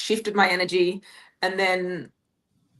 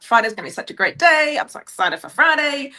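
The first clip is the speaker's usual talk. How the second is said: higher-pitched, excited quote of herself; retold excitement; 7 semitones higher, 4 dB louder than usual. Telling her listener how she felt all week, excited. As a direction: replay eager anticipation, pitched up. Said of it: "I was so excited all week".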